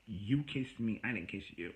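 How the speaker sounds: ordinary delivery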